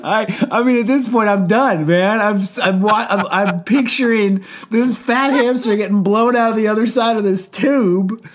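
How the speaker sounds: barely holding it together, still giggling; real giggles running under the words; laughing. Real, not acted. Still cracking up at story.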